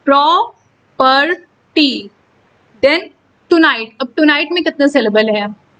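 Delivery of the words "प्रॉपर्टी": very slow प्रॉपर्टी, a pause after each syllable; split into syllables. Shown as a demonstration. Teacher showing three syllables, प्रॉपर्टी in separate slow beats. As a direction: sound out प्रॉपर्टी as pro... per... tee. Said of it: "यहाँ पर तीन syllable है".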